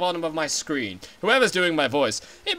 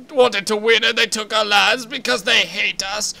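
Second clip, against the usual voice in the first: put-on higher, louder cranky old man; a shouting old advisor, indignant; ranting old Zora elder; 5 semitones higher, 5 dB louder than usual. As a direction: bluster like an outraged elder. Elder rants again.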